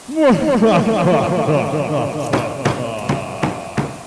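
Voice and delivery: low menacing cackle; evil laugh. Acted. Headless horseman mocking the drowning schoolmaster, low cackle. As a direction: gloat like a monster over a victim.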